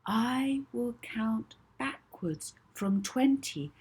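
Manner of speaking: calm hypnotist counting backwards; soothing voice. Acted. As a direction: soothe her down as you count. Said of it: "her most soothing voice".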